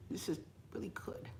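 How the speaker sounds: breathy whisper of delight. Real, not acted. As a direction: whisper it while savoring the food. Savoring food, aside.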